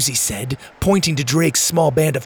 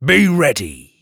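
feral snarling; 2 semitones higher, 5 dB louder, slower than usual. Acted. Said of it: "he snarled".

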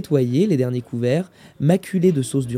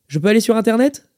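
usual speaking voice

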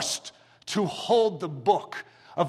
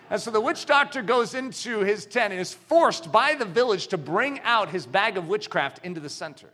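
normal talking voice

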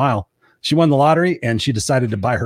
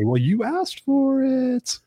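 high, slow, drawn-out cosmic reply; voice of the universe; 11 semitones higher, much slower than usual. Pretend. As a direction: play the universe teasing you. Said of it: "the universe might say".

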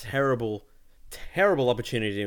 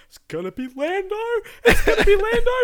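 high, loud, giddy chant breaking into a laugh; giddy excitement; 11 dB louder than usual. Retold or imitated. Telling the co-host how he hoped for Lando, high giddy squeal. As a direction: squeal it like a fan who knows. Retold hope in cinema.

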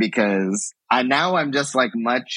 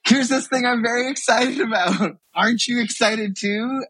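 gushing excitement; 12 semitones higher than usual. Retold or imitated. Self retold gushing to partner.

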